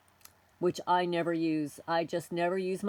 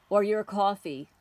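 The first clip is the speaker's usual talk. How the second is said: normal talking voice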